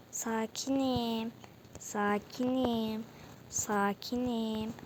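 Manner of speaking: slow, stretched-out calm; self-soothing calm. Real, not acted. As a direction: talk yourself down slowly. (ağır ağır, sakinleşmeye çalışarak)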